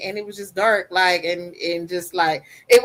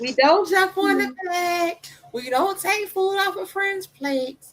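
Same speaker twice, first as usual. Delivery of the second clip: put-on child reciting; 10 semitones higher than usual. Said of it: "Say the rules".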